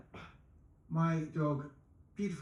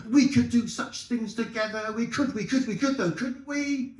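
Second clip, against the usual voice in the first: high, pleading puppy voice; eager dog; 7 semitones higher, 5 dB louder than usual. Acted. Dog pleading with the woman to be his, high and eager. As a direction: beg to be her good boy, bouncing high. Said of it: "it's got two voices".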